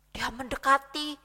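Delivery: ordinary delivery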